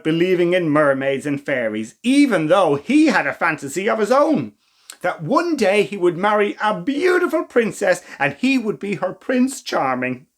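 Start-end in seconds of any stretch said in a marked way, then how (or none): none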